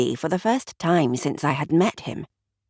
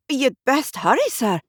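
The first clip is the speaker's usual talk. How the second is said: a hint of panic, higher; panicky clerk; 8 semitones higher than usual. A narrator's reading. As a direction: urge them on, lightly frightened. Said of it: "panic in his voice".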